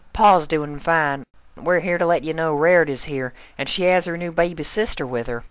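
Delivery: a busy pony apologizing on the run; hurried farm pony. Acted. Narrator voices Applejack.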